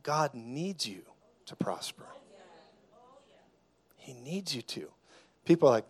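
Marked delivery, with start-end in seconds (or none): 0.0-1.0 softer, slow, near-whispered; hushed emphasis. Real, not acted. Preacher restating to the congregation, hushed. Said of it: "I'll say it a better way". 1.5-2.0 whispered. Real, not acted. Preacher pressing the point, whispered. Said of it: "I'll say it a better way". 4.0-4.9 softer, slow, near-whispered; hushed emphasis. Real, not acted. Preacher restating to the congregation, hushed. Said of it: "I'll say it a better way".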